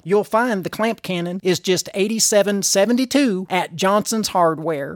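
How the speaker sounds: folksy local radio ad; Edna ad read. Acted. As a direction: sell it like a small-town hardware store ad. Reads a spoof hardware ad.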